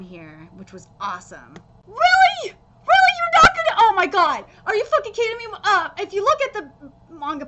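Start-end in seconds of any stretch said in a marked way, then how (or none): 1.9-3.1 high loud incredulous "Really?"; shocked disbelief. Real, not acted. Reviewer reacting to the anime skipping a scene, shrieking in disbelief. 3.2-5.4 loud high wailing protest; outraged. Real, not acted. Fan betrayed by the adaptation, yelling in loud outrage.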